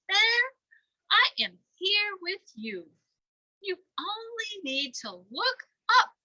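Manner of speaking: loud, reassuring owl voice; loud wise owl reassuring; kind owl. Acted. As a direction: comfort the little one.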